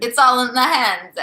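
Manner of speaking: put-on loud Italian accent with flair. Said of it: "That's how I do an Italian accent".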